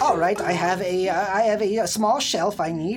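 lightly voiced fussy old woman; a hint of a doddering old lady.

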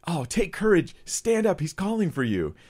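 high, hammed-up encouragement; mock a fickle crowd turned sweetly supportive; suddenly encouraging crowd. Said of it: "about face of the crowd is kind of funny".

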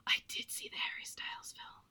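very soft, high whisper; whispered admission. Real, not acted. Admitting to the co-host which film was seen, whispered confession. Owning up when asked about film.

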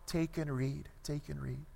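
very soft, fading refrain; hushed imitation of a child's chant; child's distant singsong. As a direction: chant it faintly beneath the cries. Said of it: "voice of this small child singing over".

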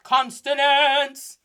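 loud, drawn-out "consonants"; exaggerated emphasis. Shown as a demonstration. Teacher saying what is not running the show, hammed-up loud. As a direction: belt out "consonants" in mock grandeur. Mock emphasis on the wrong thing.

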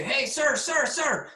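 put-on high, bright calls of an eager kid; kid calling out. Kid spotting the speaker at the grocery store, calling out high. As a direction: call up to him like an excited child.

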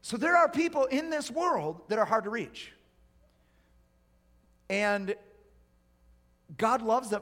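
high, loud, driving sermon delivery; full-force preaching. Real, not acted. Preacher warning those not listening, forceful. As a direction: preach it out to the whole room.

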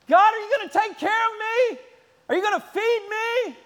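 put-on high, loud childish pleading; worried little child begging; whiny child. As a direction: whine to God like a needy kid. Anxious believer as child.

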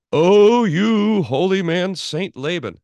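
fawning fake praise, dripping irony; sarcastic mock praise. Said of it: "a little bit of sarcasm in Luther's voice".

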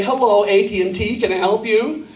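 lightly voiced American telephone operator; American switchboard patter. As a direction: greet callers with an American accent. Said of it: "change your accent and you were there".

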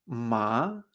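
MA en segundo tono, de grave a agudo. Shown as a demonstration. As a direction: sube MA desde abajo, como preguntando. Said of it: "había cuatro tonos y un tono neutro". («ma» en tono ascendente)

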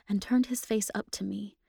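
plain reading voice

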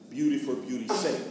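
ordinary talk, nothing marked

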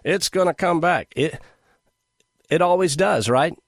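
mock market proponent. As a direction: mimic a breezy true believer. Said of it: "you stock market proponents are like".

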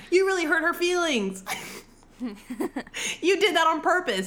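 high, put-on chiding voice; mock reproach. Roz calling him out for hurt feelings, mock reproach. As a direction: chide gently, pitched well up. Acts out Roz scolding mildly.

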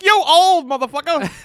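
high, loud teasing voice; high mock voice. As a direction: squeal it with a laugh. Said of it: "I was like".

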